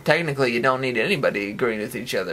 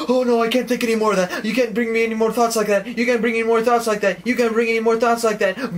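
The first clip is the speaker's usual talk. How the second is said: high, frantic, repetitive pleading; acted panic; 8 semitones higher than usual. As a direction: plead in mock meltdown, pitched well up. Acting out mental overload.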